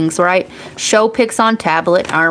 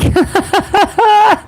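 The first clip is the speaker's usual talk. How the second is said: squealing laugh, much higher; high burst of laughter; 13 semitones higher than usual. Real, not acted. Host cracking up at a viewer's comment, high laugh.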